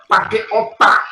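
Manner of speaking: mocking jab with a sharp rise on "pakai"; harsh scolding tone. Shown as a demonstration. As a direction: say "pakai otak" like a contemptuous rebuke. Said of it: "Coba dengan intonasi terus dengan mimik muka yang berbeda".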